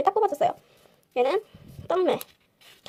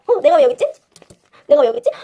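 amused surprise; 8 dB louder than usual. Real, not acted. Finds own face on item.

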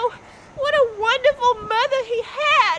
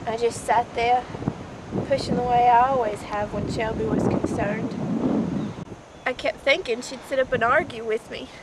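low, quiet, halting recollection; subdued, mournful remembering; hushed grief; 12 semitones lower, 10 dB quieter than usual. Acted. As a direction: let long pauses sit between soft phrases. Mother recounts her daughter's death.

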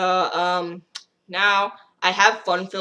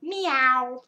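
high cartoon meow; cat filter meowing; 7 semitones higher than usual. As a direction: mew like a cute kitty. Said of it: "this cat".

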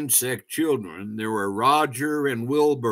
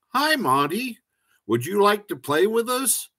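higher-pitched, lightly voiced butterfly child; friendly invitation; 9 semitones higher than usual. A narrator's reading. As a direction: invite a playmate in a high, eager voice. Friendly invitation to play in story.

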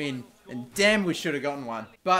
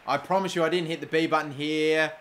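ordinary talk, nothing marked

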